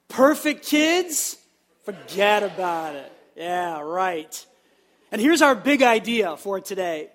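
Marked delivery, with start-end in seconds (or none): 0.1-4.4 put-on slow, drawn-out New York "Perfect kids, forget about it"; New York accent. Shown as a demonstration. Pastor urging a better second try, New York accent. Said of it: "we can do it a little bit better".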